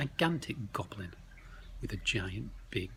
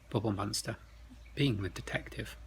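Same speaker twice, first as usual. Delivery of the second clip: ordinary delivery, nothing marked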